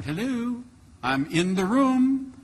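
high, drawn-out "hello"; indignant calling out. Retold or imitated. Patient reminding the doctor that he is present, indignant. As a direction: sing out slowly for the doctor's attention.

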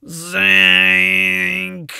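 loud low zink; zink sound. A narrator's reading. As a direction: blare the misfire. Machine misfires.

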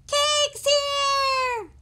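very high loud cheer; a crowd cheering a guest. Acted. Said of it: "they cheered".

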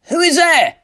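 very high, loud challenge; shouted, alarmed question; startled cry. A narrator's reading. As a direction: cry out into the dark. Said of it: "he cried".

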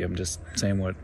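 ordinary talk

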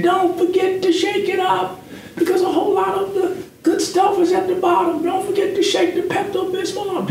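high imitated wife voice, fast in the middle; wife's reminder. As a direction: squeak it up high like his wife nagging kindly. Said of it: "and she said".

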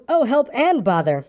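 high, slow, mildly exasperated wail. A narrator's reading. Pooh unable to go either way, mildly exasperated. Pooh stuck both ways.

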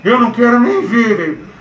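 ordinary talk, nothing marked

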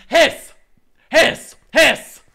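loud playful spoken hiss; mock hiss. Hissing at Andrew for a jinx, mock loud hiss. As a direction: hiss at him like an angry cat.

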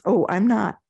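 normal talking voice

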